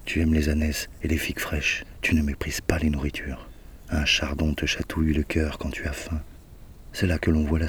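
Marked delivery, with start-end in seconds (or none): none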